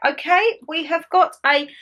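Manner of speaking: usual speaking voice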